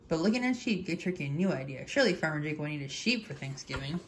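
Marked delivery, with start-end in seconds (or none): none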